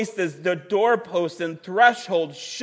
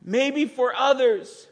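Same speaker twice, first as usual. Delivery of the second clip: higher, urgent pastoral plea; impassioned call to worship; 7 semitones higher than usual. Acted. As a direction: urge the congregation to respond.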